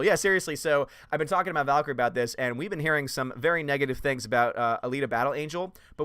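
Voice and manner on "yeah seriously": ordinary talk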